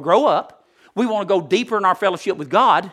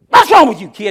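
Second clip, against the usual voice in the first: loud, high scolding outburst; angry dad yell; 6 semitones higher, 13 dB louder than usual. Acted. Dad to his child fallen off the bike, angry yell. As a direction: yell at the kid like a fed-up father.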